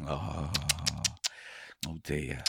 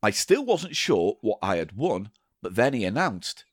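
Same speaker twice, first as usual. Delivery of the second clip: ordinary talk, nothing marked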